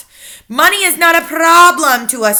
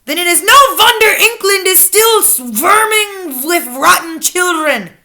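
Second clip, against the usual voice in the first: louder, higher contemptuous witch; lightly thundered scorn; angry witch leader; 10 semitones higher, 7 dB louder than usual. A narrator's reading. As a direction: sneer at the failure. Grand High Witch continues.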